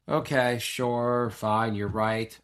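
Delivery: slow, drawn-out imitated giving in; resigned agreement. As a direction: imitate a son reluctantly going along. Imitating son's reluctant agreement.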